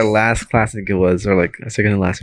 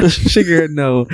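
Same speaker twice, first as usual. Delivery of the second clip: normal talking voice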